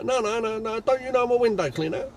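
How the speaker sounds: high, offended protest; indignant caller. Retold or imitated. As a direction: act insulted that he doesn't know you.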